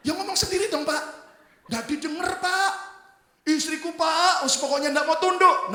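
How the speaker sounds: loud, high-pitched complaint; exasperated husband. Acted. Husband complaining to his pastor about his wife, exasperated. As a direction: plead your case in mounting exasperation.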